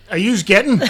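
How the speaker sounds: a Belfast cafe waitress taking orders, blunt and loud. Retold or imitated. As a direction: bark it out like a no-nonsense waitress. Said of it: "the waitress is like".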